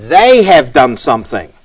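"they" punched, then falling away; deliberate, weighty emphasis on "they"; stress on THEY. Shown as a demonstration. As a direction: hit the word "they" hard. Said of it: "the emphasis here is".

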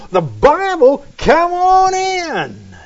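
high, loud, long-drawn welcome; delighted Rastafarian. Acted. Rastafarian hears Bible and welcomes missionaries, exaggerated delight. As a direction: exclaim it, then stretch out the invitation high.

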